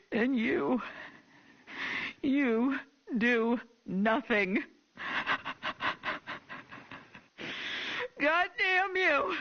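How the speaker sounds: crying, screaming curses; sobbing rage. Acted. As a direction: wail through tears, rising to fury. Grieving mother rages at officials.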